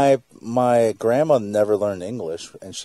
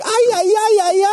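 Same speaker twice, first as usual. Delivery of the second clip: high, louder mock Mexican exclamation; mock Mexican impression; 3 dB louder than usual. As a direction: ham up a Mexican-accented cry. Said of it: "Val's daughter would be like".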